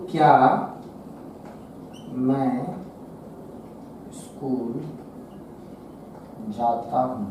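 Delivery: low, soft, each word set far apart; word-by-word dictation. Shown as a demonstration. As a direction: murmur it slowly while writing. Writing the question word by word. (धीमे, नीचे सुर में शब्द-दर-शब्द)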